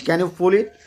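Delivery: normal talking voice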